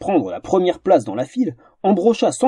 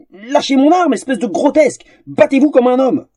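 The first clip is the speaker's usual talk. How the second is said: much higher, loud, furious protest; outraged yell; 9 semitones higher, 3 dB louder than usual. Acted. Humiliated suitor yells at the troll holding his blade, outraged. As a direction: scream it, red with shame.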